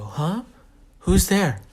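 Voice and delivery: who goes there, lightly acted; puzzled startle. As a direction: call out to an unknown voice, puzzled.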